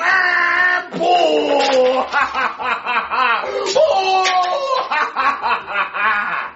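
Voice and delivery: long high shrieking cackle; maniacal laugh. Acted. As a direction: cackle like a madman. Prisoner cackling.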